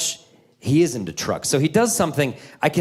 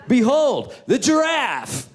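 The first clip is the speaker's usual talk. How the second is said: grand teacher reveal; 10 semitones higher, slower than usual. Retold or imitated. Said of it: "the teacher's like".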